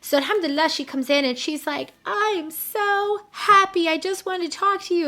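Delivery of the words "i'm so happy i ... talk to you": high, drawn-out gush of happiness; overjoyed, retold. Client calls her coach overjoyed, retold happy gush. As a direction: imitate someone bursting with good news, stretching the first words.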